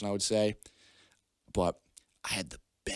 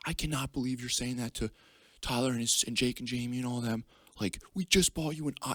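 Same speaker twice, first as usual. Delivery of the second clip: higher-pitched exasperated mock rant; scolding dad impression; 5 semitones higher than usual. Dad berating his crying son, high mock scolding. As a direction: imitate a parent's incredulous telling-off.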